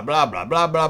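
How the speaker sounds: ordinary talk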